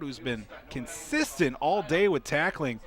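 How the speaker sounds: ordinary talk, nothing marked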